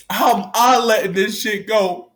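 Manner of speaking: real cry breaking high and loud. Real, not acted. Staff member breaking down as he questions himself. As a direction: voice cracking into a sob. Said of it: "y'all see me cry right now".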